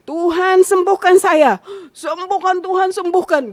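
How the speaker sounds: seruan memohon, keras dan tinggi; doa meraung-raung. Retold or imitated. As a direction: meratap lantang minta kesembuhan. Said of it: "saya nangis meraung-raung". (doa sambil meraung)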